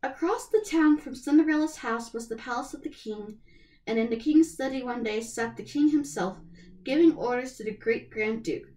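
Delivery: usual speaking voice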